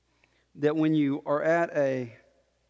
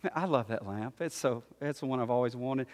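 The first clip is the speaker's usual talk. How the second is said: lower, softer inner thought; musing to oneself; 5 semitones lower, 7 dB quieter than usual. Acted. Parent thinking about the new lamp, quiet musing. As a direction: think aloud fondly, under the rest of the talk. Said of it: "you're just thinking".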